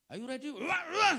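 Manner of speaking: loud, high rousing yell; high voltage shout. Shown as a demonstration. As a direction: fire the crowd up with a big shout. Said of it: "harus pakai tegangan tinggi sedikit". (teriakan bersemangat dengan suara tinggi)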